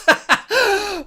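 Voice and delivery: a high, breathy giggle at his own joke. Real, not acted. Streamer giggling at his own joke about Akano, high and amused. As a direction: laugh it off, high and light.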